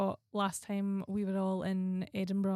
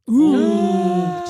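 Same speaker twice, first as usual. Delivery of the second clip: teasing ooh; 14 dB louder than usual. Real, not acted. Teasing reaction to cheeky pick.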